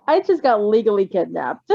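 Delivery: usual speaking voice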